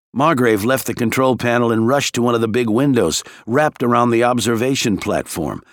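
usual speaking voice

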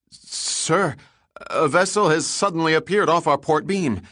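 high, loud, startled report; young helmsman, high and excited; excited stutter. A narrator's reading. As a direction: blurt it out in a rush of alarm. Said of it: "he stuttered excitedly".

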